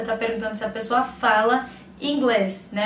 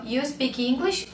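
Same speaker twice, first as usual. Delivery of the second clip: "You speak English?" slowly, lifting at "English"; question by intonation alone; 4 dB quieter, slower than usual. Shown as a demonstration. Teacher demonstrating an intonation-only question, "English" rising at the end. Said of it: "Mudar só a entonação".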